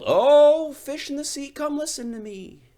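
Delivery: high, loud call to the sea; sing-song call across the water. Acted. Fisherman calls out over the sea to the fish, high loud call.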